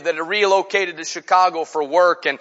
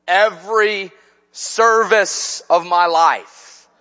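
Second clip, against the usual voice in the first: ordinary talk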